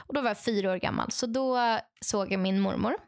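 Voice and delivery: normal talking voice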